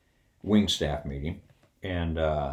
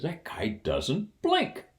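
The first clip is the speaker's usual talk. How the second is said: amazed retold friend; 14 semitones higher than usual. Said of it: "He says".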